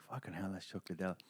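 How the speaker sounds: under-the-breath star-spotting; hushed aside. Retold or imitated. Telling how he spotted Chuck Liddell walking by, hushed aside. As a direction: murmur it softly to a mate.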